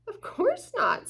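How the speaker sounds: lightly voiced prim otter. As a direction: toss it off like a sleek otter. Miss Otter answers.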